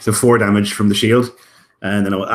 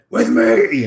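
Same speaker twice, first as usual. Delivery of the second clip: higher, louder rallying shout; screamed battle cry; 5 semitones higher, 6 dB louder than usual. Acted. Warrior charging at the creature, screaming for others to follow. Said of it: "he's gonna scream".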